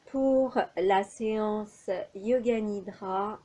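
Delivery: ordinary delivery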